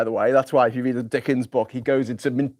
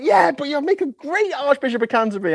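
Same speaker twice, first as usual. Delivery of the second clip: much higher, airy reassurance; breezy dismissive appointer; 14 semitones higher than usual. Pretend. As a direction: brush off the objection cheerfully. Mock reply from appointer.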